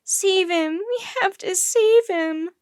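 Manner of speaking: high, loud desperate begging; distraught high-pitched cry; tearful plea. A narrator's reading. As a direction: beg through tears, stretching the words. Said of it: "Tears were streaming down her cheeks".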